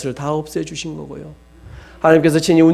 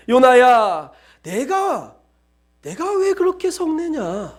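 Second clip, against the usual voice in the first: much higher rebuking question; voice of God; 8 semitones higher than usual. Retold or imitated. God questioning Jonah about his anger, high rebuke. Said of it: "하나님께서 말씀하시는 것입니다".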